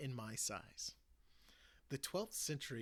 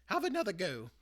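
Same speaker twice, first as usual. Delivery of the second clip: plain reading voice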